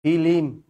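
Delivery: ফিলিম as two syllables, fi-lim; rustic fi-lim for ফিলিম; split cluster. Shown as a demonstration. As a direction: slip an i between l and m in ফিলিম. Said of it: "ফিল্ম হয়ে গেল কি?".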